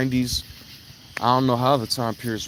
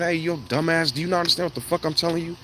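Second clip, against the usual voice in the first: higher and fast, a mock telling-off; mock exasperated scold; 6 semitones higher than usual. Speaker mimics scolding someone who won't listen, fast and exasperated. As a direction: snap it quick like scolding a fool. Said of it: "That's like me saying".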